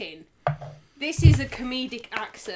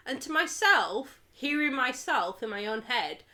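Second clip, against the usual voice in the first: ordinary talk, nothing marked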